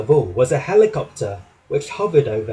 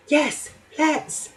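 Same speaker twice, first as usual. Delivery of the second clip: high, eager little engine voice; excited; 13 semitones higher than usual. A narrator's reading. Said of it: "said Percy excitedly".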